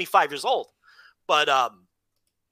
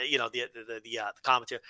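usual speaking voice

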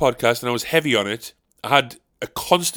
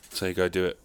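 ordinary delivery

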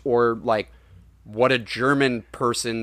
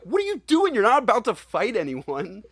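much higher, squeaky disbelief; incredulous mock; 9 semitones higher than usual. Mocking a villain who huffs power for nothing, high incredulous squeal. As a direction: squeal it like you can't believe what he's doing. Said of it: "and I'm like".

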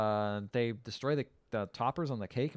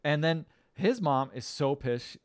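ordinary talk, nothing marked

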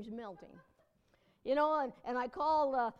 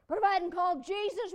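usual speaking voice

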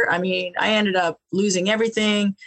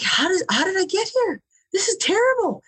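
higher, anguished disbelief; despairing; 10 semitones higher than usual. Retold or imitated. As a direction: cry out in despair. Despairing at shelter.

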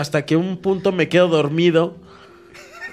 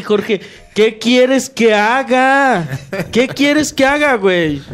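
slow, high drawn-out whine; mocking Jorge; 6 semitones higher, 3 dB louder, much slower than usual. Imitating Jorge brushing him off, slow, high and whiny. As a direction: stretch it out like you couldn't care less.

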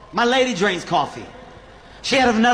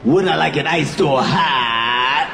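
lower, slow, laughing repeat of the question; nervous repeat; 6 semitones lower, slower than usual. Shown as a demonstration. Comic stalling at the barista, repeating the question low and slow. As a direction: echo it back, stalling with a laugh. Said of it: "when I get nervous, I just repeat shit".

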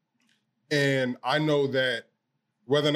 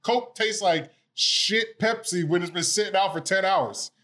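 excited; 6 semitones higher than usual. Real, not acted. Heated Coke Pepsi argument.

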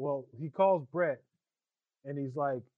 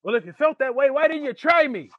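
much higher, much louder furious challenge; angry yelling; 10 semitones higher, 13 dB louder than usual. Retold or imitated. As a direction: bellow it at the caller, enraged. Stu gets worked up on the phone.

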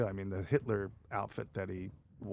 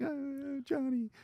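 mock crying; much slower than usual. Girl crying over injured man, high mock sobbing. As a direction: whimper the name high, hamming up the tears. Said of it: "like, crying, like".

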